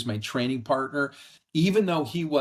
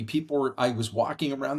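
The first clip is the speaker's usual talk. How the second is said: normal talking voice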